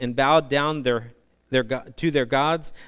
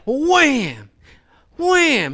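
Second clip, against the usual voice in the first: high, loud wham wham; mock beating sound; 9 semitones higher, 5 dB louder than usual. Preacher acting out Balaam beating the donkey, loud high whams.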